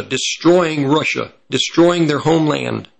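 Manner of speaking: ordinary delivery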